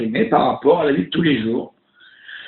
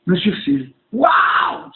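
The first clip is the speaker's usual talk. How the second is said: excited cry; 8 semitones higher, much slower than usual. Retold or imitated. Spotting the TV host live.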